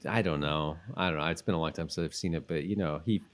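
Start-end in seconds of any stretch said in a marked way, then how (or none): none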